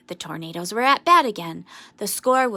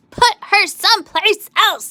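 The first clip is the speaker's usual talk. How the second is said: high, loud angry shout; 10 semitones higher, 10 dB louder than usual. A narrator's reading. Angry Tornadoes shouting to move Amelia Bedelia, loud and high. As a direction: yell it at the other team. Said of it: "they shouted".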